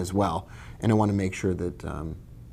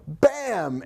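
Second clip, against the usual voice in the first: high bam; mock bam; 8 semitones higher than usual. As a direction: pop it out. Acted explosion sound.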